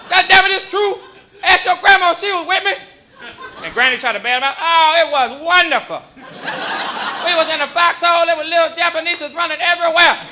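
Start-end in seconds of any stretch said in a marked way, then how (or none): 0.1-2.7 put-on high, loud boastful old man; bragging grandpa at dinner; old war veteran. Grandfather telling war stories at Thanksgiving. 4.6-10.2 grandma covering for grandpa; granny voice. Retold or imitated. Granny backing up grandpa's war story at dinner.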